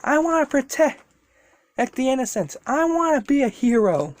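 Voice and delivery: high, pleading hero voice; earnest young hero. Acted. Deku answering the serpent spirit, high and earnest. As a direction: pitch up, vowing it sincerely. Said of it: "He's like".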